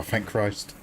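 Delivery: ordinary talk, nothing marked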